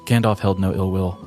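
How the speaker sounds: usual speaking voice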